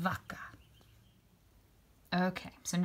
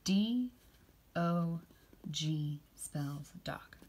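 slow, softer repeat of G spells dog; slow, soft spelling; much slower than usual. Shown as a demonstration. Teacher repeating dog with the kids, slowly and softly. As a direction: echo the spelling slowly and gently. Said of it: "Can you say that with me".

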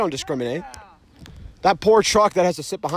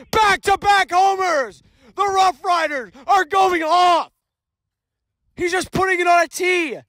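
high shouted call of back-to-back homers; excited shout; 8 semitones higher than usual. Real, not acted. Commentator hyping back-to-back homers, excited shout. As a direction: yell it out, the team is on fire. Second home run call.

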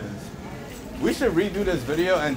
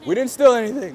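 loud, high, joking protest of innocence; mock shout; 8 semitones higher, 4 dB louder than usual. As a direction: call it out like a fake-innocent shoplifter. Joking at Walmart checkout exit.

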